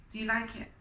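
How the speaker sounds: ordinary delivery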